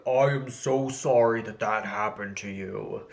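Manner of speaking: a hammed-up tough guy; mock manly voice. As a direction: puff up and sound as manly as you can. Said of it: "really drove home how manly I was".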